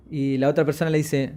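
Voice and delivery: usual speaking voice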